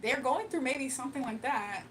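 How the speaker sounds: normal talking voice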